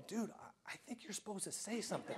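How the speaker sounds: a soft, near-whispered nudge; hushed aside. Retold or imitated. Quietly coaching the silent robber, hushed. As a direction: lean in and murmur it so nobody else hears. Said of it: "I want to say to him".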